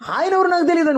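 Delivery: high, panicked denial; mock-frightened high denial; Peter's denial. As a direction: act Peter fearfully denying Jesus. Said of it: "మూడు సార్లు చెప్పినాడు".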